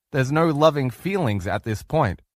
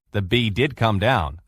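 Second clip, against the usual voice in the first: ordinary talk, nothing marked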